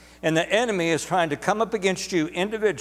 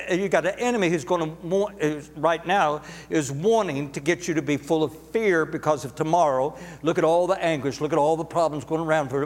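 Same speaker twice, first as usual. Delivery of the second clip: ordinary talk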